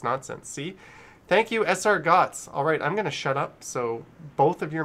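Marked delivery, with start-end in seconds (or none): 1.3-2.5 loud, lifted thanks; donor thank-you. Real, not acted. Streamer thanks a viewer for a message, loud shout-out. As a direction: call out your thanks high and loud.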